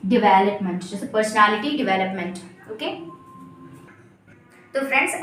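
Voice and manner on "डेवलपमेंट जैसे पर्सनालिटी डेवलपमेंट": "डेवलपमेंट" with di-VEL at the start; correct development. Shown as a demonstration. Teacher giving an example phrase, di-vel-up-ment. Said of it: "क्या बोलेंगे?".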